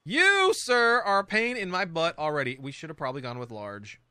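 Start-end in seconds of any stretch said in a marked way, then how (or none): 0.0-2.6 louder, higher mock-formal telling-off of a stubborn object; mock scolding. Real, not acted. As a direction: scold the pillar like a naughty gentleman. Scolds a game piece.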